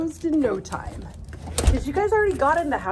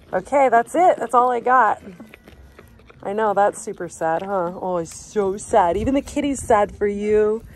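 ordinary talk

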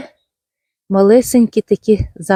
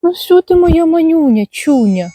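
cooing to a pet; 7 semitones higher than usual. Real, not acted. Gardener greets her adopted cat mid-video, high affectionate coo.

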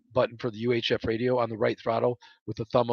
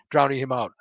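plain reading voice

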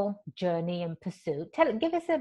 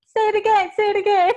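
put-on high, loud, delighted plea to hear the accent again; charmed American fan; 13 semitones higher, 7 dB louder, faster than usual. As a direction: beg happily like an enchanted American. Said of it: "they'll just kind of smile and say".

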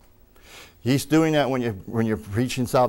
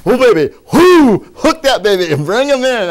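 loud, high thrilled whoop; excited whooping; 8 semitones higher, 9 dB louder than usual. Acted. Preacher hooking a person like a trout, excited loud whooping. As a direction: cheer on a big catch.

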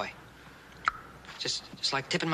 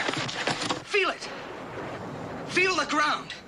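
excited; 9 semitones higher, much slower than usual. Real, not acted. Excited teaching breakthrough.

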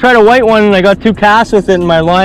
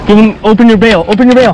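urgent raised instruction; fighting a fish; 5 semitones higher, 3 dB louder than usual. Real, not acted. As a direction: bark it to the partner mid-fight. Shouting instruction during fight.

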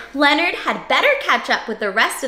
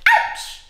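mock yelp. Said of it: "and he went".

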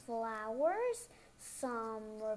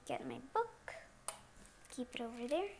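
ordinary talk, nothing marked

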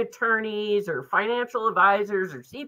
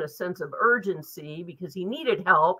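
usual speaking voice

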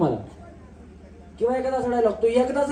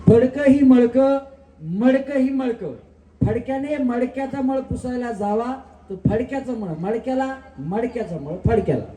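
each syllable slow and distinct; slow clear tongue twister. Shown as a demonstration. Host demonstrating the tongue twister to contestants, slow and clear. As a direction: spell the twister out slowly for the contestants. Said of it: "ह्याच्यावर नाही दादा तुम्हाला खेळायचं".

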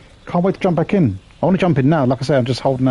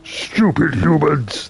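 slow rasping strained mutant growl; super mutant voice; much slower than usual. Acted. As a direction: snarl it like a brutish super mutant. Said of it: "there's my, um, super mutant impression for you".

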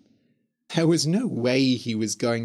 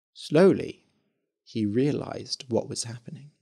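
plain reading voice

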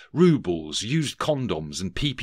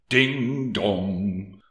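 sing-song bell chime ding dong; playful clock chime; chiming bell. Acted. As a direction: ring it out like Big Ben. Narrator imitates Big Ben chime.

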